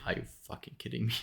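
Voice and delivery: rapid hushed exasperation; muttered disbelief. Retold or imitated. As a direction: mutter it fast to yourself in disbelief. Exasperated inner reaction to error.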